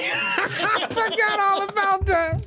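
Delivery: high, slow, cracking up; laughing recall. Real, not acted. Remembering a funny moment to co-host, laughing high. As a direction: laugh as it comes back to you.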